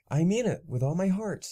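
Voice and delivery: exclaimed. Acted. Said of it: "I exclaimed".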